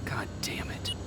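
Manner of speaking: hushed whisper under the breath. Acted. Sneaking soldier whispering to himself about the dead tracker. Sneaking past enemy machines.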